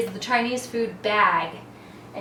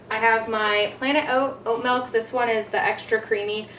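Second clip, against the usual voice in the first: ordinary delivery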